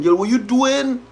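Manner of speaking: high, startled man crying out; mock shout of a caught boyfriend; imitating boyfriend yelling. Boyfriend sees his car keyed, mock shouting. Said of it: "he run out shouting".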